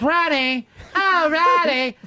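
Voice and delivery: high-pitched, hammed-up swooning call; bad Fez impression. Host mimics colleague's campy impression, high melodramatic calling. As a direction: overact a campy, stagey name call. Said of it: "when he does Fez".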